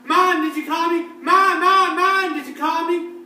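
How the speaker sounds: high loud calling for Mom. Acted. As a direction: shout for Mom across the house. Child running calling mom.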